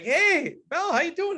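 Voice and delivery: put-on high, bright hello to an old student; cheery greeting. Superintendent spotting former student at the gate, high cheery hello. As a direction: sing out a happy surprised hi. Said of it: "And I'm like".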